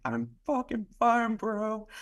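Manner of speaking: imitated tearful, high cracking voice; mock cracked-voice insistence, slow and high. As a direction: stretch it out high, voice breaking as if crying. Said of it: "like, voice cracking".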